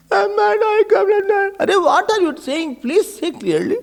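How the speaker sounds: put-on an alarmed mum fussing over her crying boy; anxious mother. Mother asks crying child.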